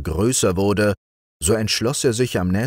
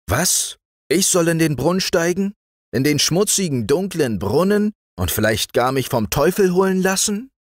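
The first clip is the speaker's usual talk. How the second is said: much higher, alarmed disbelief; shocked outcry; 11 semitones higher than usual. A narrator's reading. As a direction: recoil in horror at the idea. Sultan appalled at the advice.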